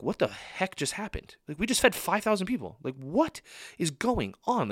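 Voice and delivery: high, incredulous disciples comparing notes; baffled disbelief. Acted. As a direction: act out astonished bewilderment, voice swinging up high. Disciples' baffled talk after the miracle acted out.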